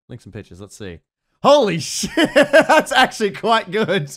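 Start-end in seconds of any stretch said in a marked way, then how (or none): 1.4-4.1 loud, slow praise broken by laughter; amused amazement. Real, not acted. Player seeing friends' picture link, laughing in amazement. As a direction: raise your voice, cracking up as you praise.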